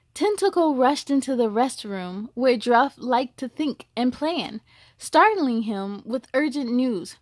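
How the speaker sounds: gentle storybook reading; storyteller voice for children; story narration. A narrator's reading. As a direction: read it like a bedtime story.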